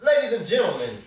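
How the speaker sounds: high-pitched showman's announcement; sing-out opening call to the crowd; announcer call. Pretend. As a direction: announce it like a ringmaster. Announcing the draw to viewers.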